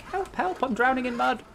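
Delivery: high-pitched mock panic; teasing, high damsel-in-distress wail; mock cry for help. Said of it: "And you'll be like".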